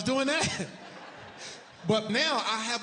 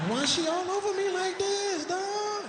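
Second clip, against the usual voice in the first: high whiny disbelief; 13 semitones higher than usual. Retold or imitated. Asking Dave whether nurse is a fan.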